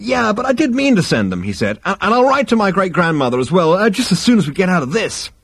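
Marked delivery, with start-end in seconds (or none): 0.0-1.4 high-pitched, flustered defence; nervous protest. A narrator's reading. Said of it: "Zaphod fidgeted nervously". 1.8-5.3 fast, high, stammering appeasement; nervous promise. A narrator's reading. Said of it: "Zaphod fidgeted nervously".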